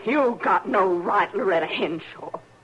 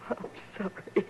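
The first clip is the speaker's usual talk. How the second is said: tearful whisper; 10 dB quieter than usual. Acted. She apologizes after crying.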